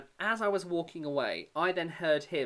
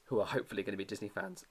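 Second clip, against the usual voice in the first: ordinary delivery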